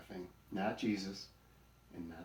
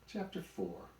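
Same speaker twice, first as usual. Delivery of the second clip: ordinary delivery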